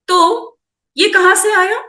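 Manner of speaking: sudden high, loud question; surprised question. Real, not acted. Asking where the belief came from. (अचानक ऊँची, ज़ोरदार हैरानी भरी आवाज़ में)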